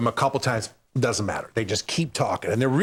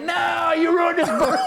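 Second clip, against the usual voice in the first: mock crowd shout. Audience shouts back at the comedian, mock yell. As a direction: shout him down like an angry crowd.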